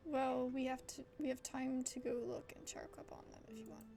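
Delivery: usual speaking voice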